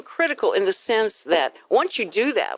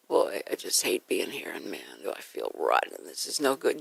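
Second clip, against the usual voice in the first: very low, soft, dejected grumble; mock miserable; 8 dB quieter than usual. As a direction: play a glum radio host dragging out complaints. Mimics miserable radio host for contrast.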